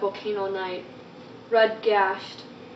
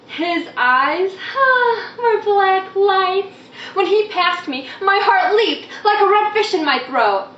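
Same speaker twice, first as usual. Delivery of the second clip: high, loud rapturous sigh into words; breathless-with-wonder girlish delivery; swooning admiration; 9 semitones higher, 5 dB louder than usual. Acted. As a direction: gush over a childhood crush. Dreamy crush, performed in poem.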